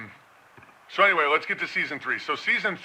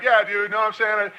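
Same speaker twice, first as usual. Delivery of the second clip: mock mirroring; 6 semitones higher, faster than usual. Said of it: "you just start being like".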